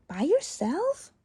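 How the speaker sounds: high, slow shocked question. Acted. Child shocked at dad going alone, high and slow. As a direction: gasp it out in disbelief.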